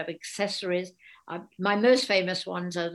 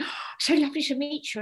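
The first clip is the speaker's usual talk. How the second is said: gushing fan; 7 semitones higher, faster than usual. Retold or imitated. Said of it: "and they go".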